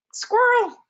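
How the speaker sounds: high startled blurt; sudden distracted outburst. Real, not acted. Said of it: "Got distracted".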